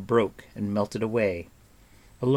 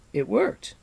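high rising gasp on worked; astonished cry; 10 semitones higher than usual. Acted. Bard sees the riders turn back, astonished rising cry. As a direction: disbelief turning to joy, tune rising on "worked". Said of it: "cried the astonished Fflewddur".

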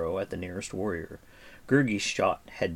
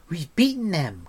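high, excited cheer; triumphant cry; 9 semitones higher, 4 dB louder than usual. Acted. Eilonwy sees the horsemen retreat, crying out. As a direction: cry victory with a lifted voice. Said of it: "cried Eilonwy".

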